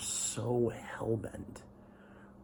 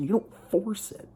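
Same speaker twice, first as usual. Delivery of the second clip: much higher and louder on force; emphatic outburst; 11 semitones higher, 8 dB louder than usual. Real, not acted. Venting about forced blessings, loud and emphatic. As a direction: slam the point home.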